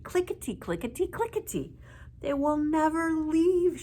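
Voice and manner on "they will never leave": high, loud shout. A narrator's reading. Old woman shouting about the fairies. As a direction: shout it in despair. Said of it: "shouted the old woman".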